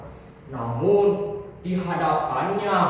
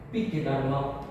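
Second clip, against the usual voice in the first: ordinary delivery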